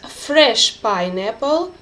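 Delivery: usual speaking voice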